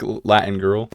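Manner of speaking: ordinary delivery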